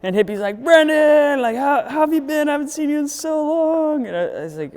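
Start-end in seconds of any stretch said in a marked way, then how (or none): 0.6-4.1 high, loud friendly greeting; excited greeting. Retold or imitated. Hippie Mike welcoming Brendan at the shop, high excited greeting. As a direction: welcome him back with delight.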